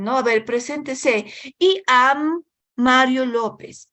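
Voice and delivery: slow mispronounced I am; mock learner reading it out stiffly; lightly voiced struggling student; imitating a student. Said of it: "estudiantes que a nivel intermedio dicen".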